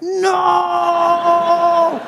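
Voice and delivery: sudden high panicked cry of no; panicked yell. Retold or imitated. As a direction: yelp in terror at the oncoming car. Scared yell at oncoming car.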